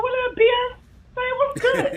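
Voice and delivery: high, loud excited hello to a celebrity; hyped greeting. Pretend. Greeting [name] walking into the room, loud and excited. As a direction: greet her like an old friend, pumped. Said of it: "I'd be like".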